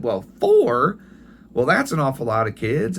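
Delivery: put-on an old mother taken aback by the number; surprised grandma. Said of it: "She said".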